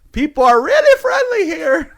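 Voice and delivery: high, loud, laughing put-on voice; hammed-up husband voice, cracking up; laughing mock husband. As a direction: squeal it through a laugh, teasing. Imitating husband coming home.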